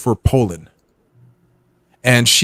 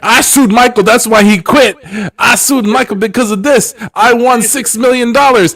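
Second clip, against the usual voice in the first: higher, louder boastful mock voice; mocking the friend's lawsuit claims, higher boastful voice; 8 semitones higher, 4 dB louder than usual. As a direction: brag like a smug blowhard. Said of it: "he's been saying that".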